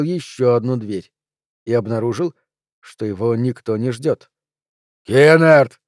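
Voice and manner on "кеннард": growling angry shout; growled shout. A narrator's reading. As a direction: snarl the name out. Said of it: "прорычал он".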